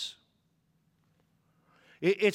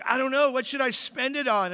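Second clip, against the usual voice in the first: put-on a child asking mom eagerly; excited little boy; 7 semitones higher than usual.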